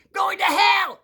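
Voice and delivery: high furious shout; screaming. Retold or imitated. Nun screaming a third time at the boy, shouting. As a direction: scream it at him full force. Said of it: "She said, three".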